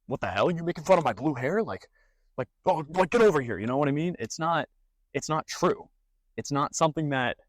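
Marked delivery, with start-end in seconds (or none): 0.1-3.6 mock outrage. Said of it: "Am I gonna be like".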